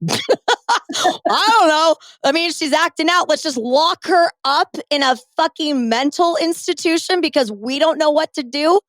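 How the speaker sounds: high, drawn-out mock parent whine; mocking clueless parents. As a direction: play the baffled parent, stretching every word.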